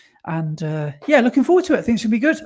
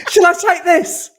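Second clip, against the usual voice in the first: high, loud and slow, hamming it up; a silly pitched-up joke voice; mock comic voice; 11 semitones higher, 8 dB louder than usual. Joking to co-host about taking a cup, high and hammy.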